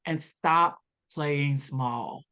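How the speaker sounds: very slow, every word landing; slow, weighty advice. Retold or imitated. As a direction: deliver it slowly like a life-changing truth. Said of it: "She said".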